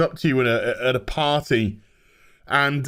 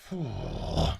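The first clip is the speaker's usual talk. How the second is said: weary sigh; 9 semitones lower, 9 dB quieter than usual. Real, not acted. Disgust at the show.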